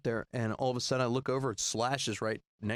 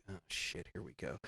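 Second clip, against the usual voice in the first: much softer and lower, under his breath; muttered aside; 8 semitones lower, 13 dB quieter than usual. Retold or imitated. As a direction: mutter it to yourself, expecting a prank. Inner thought before the prank.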